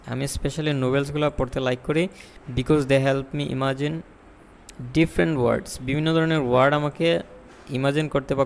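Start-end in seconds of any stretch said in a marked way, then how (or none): 2.6-5.7 slow, careful example; deliberate slow example; slow model answer. Shown as a demonstration. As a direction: read the good answer slowly. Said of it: "আনসারটা দেখেন".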